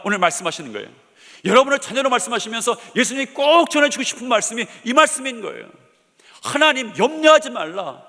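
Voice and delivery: loud, high impassioned preaching; fervent sermon peak; preaching at full force. Acted. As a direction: drive the climax home to the congregation. Climax of sermon.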